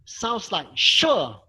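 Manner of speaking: "sure" shouted, falling sharply from high. Shown as a demonstration. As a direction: snap "sure" down hard like an exclamation. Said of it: "You tend to shout a little bit".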